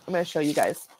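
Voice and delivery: talking through hiccups; interrupted by small hiccups. Real, not acted. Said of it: "Sorry, I have the hiccups".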